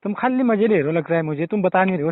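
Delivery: ordinary talk, nothing marked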